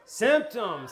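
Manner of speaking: Symptoms said loud and high, falling hard; punched key word. Shown as a demonstration. Teacher announcing the key word to the class, punched loud. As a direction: land Symptoms like a headline. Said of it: "Someone say symptoms".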